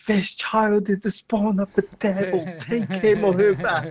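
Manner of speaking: high, slow put-on woman's voice; drawn-out, high-pitched mock complaint; outraged woman at the door. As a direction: play a mother disowning her devil child. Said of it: "some woman knocking on my door going".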